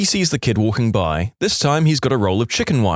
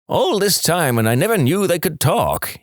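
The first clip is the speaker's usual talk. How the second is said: higher-pitched mild amazement; disbelieving; 7 semitones higher than usual. A narrator's reading. Farmer amazed by his talking animals, mild disbelief. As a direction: marvel at it, pitched up. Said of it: "the farmer can't believe what he's seeing".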